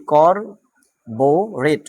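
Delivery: করবোরেট drawn out very slowly, each syllable separate; করবোরেট stretched slow syllables for a vocabulary class; slow syllable-by-syllable pronunciation. Shown as a demonstration. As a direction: spell out করবোরেট slowly for students, kor-bo-ret. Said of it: "এভাবে যদি আমরা করি".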